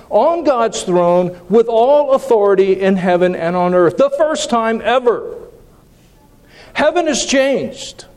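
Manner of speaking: pitched high, urgent proclamation; fervent preaching. Acted. Preacher proclaiming to the congregation that heaven has changed, fervent and high. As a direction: proclaim it like news that changes everything.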